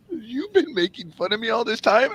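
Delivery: mock wounded realization; hurt disbelief. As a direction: realize you were mocked. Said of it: "the look on their face".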